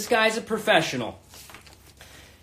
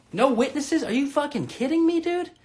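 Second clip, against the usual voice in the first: mock outrage; 8 semitones higher than usual. Real, not acted. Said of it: "This is the problem".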